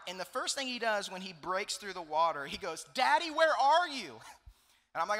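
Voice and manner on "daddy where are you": loud, high, drawn-out mock panic cry; freaking-out child. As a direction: imitate a panicked kid yelling for Dad. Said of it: "he's freaking out".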